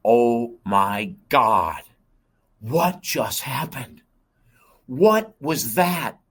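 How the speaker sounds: stunned cry, loud and drawn out, climbing high; retold scream. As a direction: re-enact leaping awake shouting in shock, stretching each word. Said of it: "I leaped out of bed screaming".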